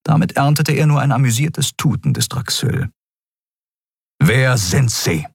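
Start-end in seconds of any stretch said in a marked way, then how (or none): 4.2-5.3 slow, each word set apart sharply; sharp word-by-word demand; cutting, stressed words. A narrator's reading. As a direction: stress every single word like an axe falling. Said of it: "betonte jedes einzelne Wort mit der Schärfe eines Henkerbeils".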